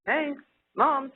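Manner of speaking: put-on high, small baby voice calling for mom. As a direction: play the infant trying to get mom to look. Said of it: "the child would go".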